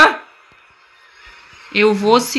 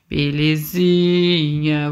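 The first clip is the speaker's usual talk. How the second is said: normal talking voice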